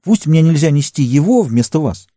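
high, urgent exclamation; exclaiming. A narrator's reading. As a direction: cry it out with rising resolve. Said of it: "воскликнул он".

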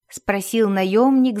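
ordinary delivery

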